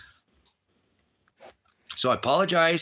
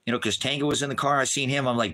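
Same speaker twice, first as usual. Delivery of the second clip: ordinary talk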